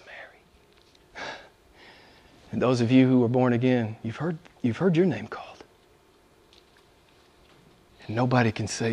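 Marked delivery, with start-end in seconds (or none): 0.1-1.3 tender whispered name. Acted. Jesus calling Mary's name. 4.0-5.6 hushed, intimate whisper. Real, not acted. Preacher drops to intimate whisper.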